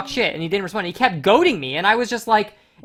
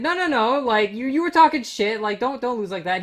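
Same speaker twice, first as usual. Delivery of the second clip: incredulous scolding; 8 semitones higher than usual. Retold or imitated. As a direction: scold him for folding, disbelieving. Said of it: "And it's like".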